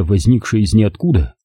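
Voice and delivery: plain reading voice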